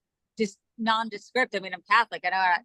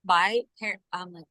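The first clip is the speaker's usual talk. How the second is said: ordinary talk, nothing marked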